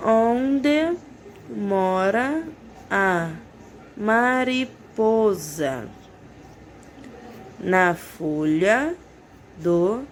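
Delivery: put-on teacher reading a children's verse slowly; slow read-aloud for children. Shown as a demonstration. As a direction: read the poem aloud word by word for little ones. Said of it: "vamos realizar a leitura".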